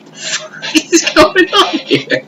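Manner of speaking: laughed out slow and high, fuck said in disbelief; laughing disbelief. Retold or imitated.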